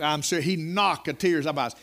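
ordinary talk

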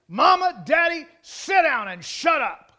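put-on shouted, slow bratty child's command; defiant child shouting. Preacher voicing a child ordering parents around, shouting. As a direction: yell it at mama and daddy like a spoiled kid.

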